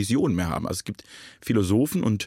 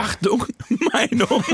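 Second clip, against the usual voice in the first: laughing jingle; 11 semitones higher, slower than usual. Pretend.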